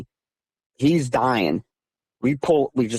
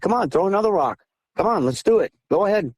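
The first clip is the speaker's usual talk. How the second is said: high-pitched goading dare; egging them on, pitched up; taunting; 8 semitones higher, faster than usual. Retold or imitated. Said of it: "I was taunting".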